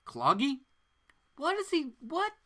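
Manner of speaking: high, rising disbelief; incredulous. Real, not acted. Host baffled by the word cloggy, incredulous. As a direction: repeat the odd word in disbelief.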